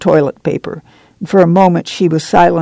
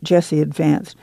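plain reading voice

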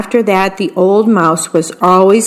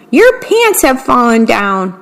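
ordinary delivery

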